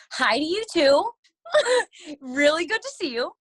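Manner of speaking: loud, high mock-sweet greeting through laughter; sarcastic reply. Sister snaps back sarcastically at her accusing brother. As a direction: fake cheer at the brother's insult.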